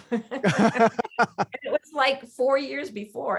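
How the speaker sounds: laughing through the words, drawn out slowly; real laugh at the surprise of it. Real, not acted.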